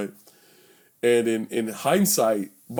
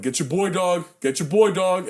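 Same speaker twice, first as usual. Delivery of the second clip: higher, urging shout to a friend; retold rowdy calls; 6 semitones higher than usual. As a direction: call out like drunk guys at a fight. Rowdy guys goad him in retold fight.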